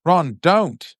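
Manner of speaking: a touch of anger, scolding Ron; Hermione, mildly angry, stopping Ron. A narrator's reading. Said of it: "said Hermione angrily".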